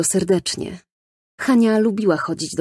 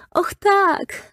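put-on high, eager child's voice; little girl's delighted cry. A narrator's reading. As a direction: squeal it out like a thrilled little girl. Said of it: "zawołała Hania". (radosny, wysoki głos dziewczynki)

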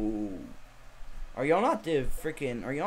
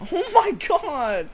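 loud, drawn-out high-pitched oh my god; shocked yell; 6 dB louder, much slower than usual. Real, not acted. Sudden shock in game.

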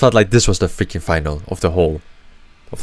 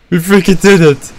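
loud, high victory shout; triumphant; 8 semitones higher, 6 dB louder than usual. Real, not acted. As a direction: cheer the win. Team wins the match.

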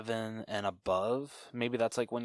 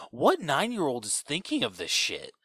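loud, high, drawn-out disbelief; hammed-up incredulous outburst; mock incredulity; 5 semitones higher, 5 dB louder than usual. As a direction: exclaim it as an exasperated viewer. Acted incredulous reaction to film dialogue.

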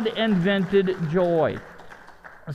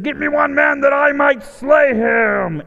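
loud, slow, higher giant bellowing a challenge; booming giant's taunt; 6 semitones higher, 5 dB louder, slower than usual. Retold or imitated. Goliath taunting the Israelite army, booming loud. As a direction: roar it like a mocking giant warrior.

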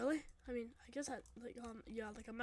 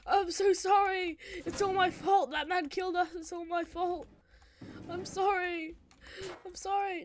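higher-pitched, anguished apology; guilt-stricken pleading; 5 semitones higher, 4 dB louder than usual. Acted. Blaming self for friends' deaths, guilt-stricken. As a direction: plead in remorse, raised pitch.

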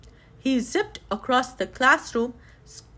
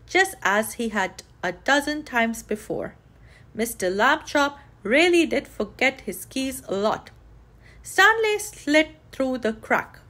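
ordinary delivery, nothing marked

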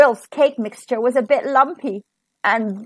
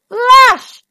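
loud, high burst; storybook magic bang; dramatic exclamation; 11 semitones higher, 10 dB louder than usual. A narrator's reading. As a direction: fire the word off like a spell. Spell goes off in story.

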